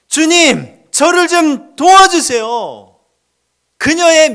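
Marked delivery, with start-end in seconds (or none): 0.1-3.0 louder, higher, slow desperate cry. Retold or imitated. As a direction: beg at the top of your voice. Plea rising to a cry. (간절히 부르짖는 목소리)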